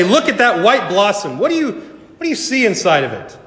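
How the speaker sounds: high-pitched, curious questioning; eager wonder. Pretend. Guide showing a companion the blossom at Easter, eager wonder. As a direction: point it out with bright curiosity.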